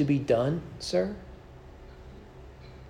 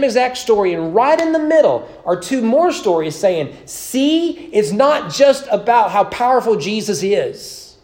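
loud, rising sermon cadence, driving each line home; full-force preaching; 4 semitones higher, 4 dB louder than usual. Acted. Preacher to his congregation about the miracles, loud and impassioned. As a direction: preach it out, building the fire.

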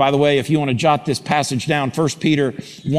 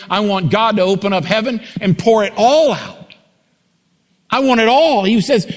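rising preacher fervor; 6 semitones higher than usual. Acted. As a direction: climb to a passionate cry. Preaching rises to full force.

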